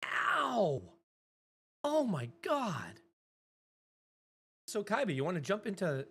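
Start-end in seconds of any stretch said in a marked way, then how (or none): 0.0-3.0 high, loud wince, drawn out; pained cry. Real, not acted. Streamer watching something painful, high pained outcry. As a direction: yelp in sympathetic pain, slowly falling.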